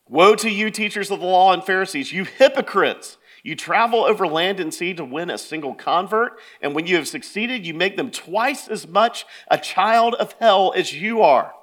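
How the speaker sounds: forceful scripture proclamation; in-their-face rebuke. Acted. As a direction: hurl the charge at them, punching the ending. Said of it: "Jesus gets in their face".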